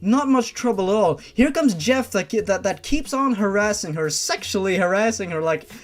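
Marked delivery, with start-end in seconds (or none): none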